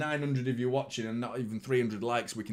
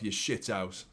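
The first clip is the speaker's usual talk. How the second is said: ordinary talk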